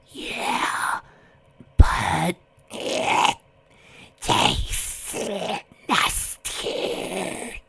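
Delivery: halting, voiceless monster burble; labored creature complaint from underwater. A narrator's reading. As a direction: force out each word separately in a watery rasp. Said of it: "as though its origins came from practically underwater".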